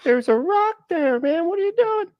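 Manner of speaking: very high, strained cartoon donkey squeal. The donkey complaining to Balaam about the wall, high squeaky donkey voice. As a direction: squeak it out like a hurt, whiny donkey.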